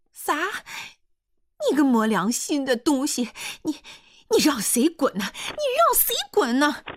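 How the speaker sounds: high, loud indignant woman; outraged dialect shrieking, rising with each question; outraged shouting. A narrator's reading. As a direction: fire back in furious disbelief. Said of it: "胡彩香说着".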